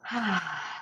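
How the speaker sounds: long, soft sigh; relaxing breath out. Shown as a demonstration. Host showing clients how to breathe, relieved soft sigh. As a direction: let the breath fall out softly. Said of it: "'Cause when you breathe".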